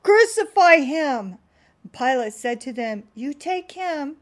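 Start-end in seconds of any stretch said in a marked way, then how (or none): 0.0-1.4 loud, higher, slow cry; crowd crying out. Acted. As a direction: roar it out like an angry mob. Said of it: "they cried out".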